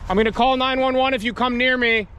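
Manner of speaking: calling out. Real, not acted. Threatening unseen figures.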